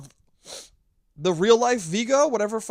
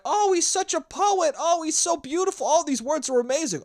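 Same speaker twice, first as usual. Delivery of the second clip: gushing Italian family; 9 semitones higher than usual. Retold or imitated. Italian family admiring his letter, hammed-up high gushing. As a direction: swoon over the letter in a put-on high voice. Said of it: "the entire Italian family gets the letter back".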